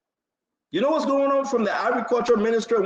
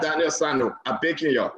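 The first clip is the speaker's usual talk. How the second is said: ordinary talk, nothing marked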